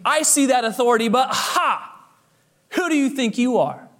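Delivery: acted, dismissive retort. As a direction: challenge his authority with a scoff. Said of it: "Almost as a dismissive way of saying".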